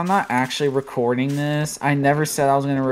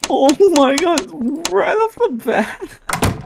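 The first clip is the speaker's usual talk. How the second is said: high, slow hammed-up squeal; high mock panic; 14 semitones higher, slower than usual. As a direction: squeal it out slowly in mock horror. Mock panic over jumpscare.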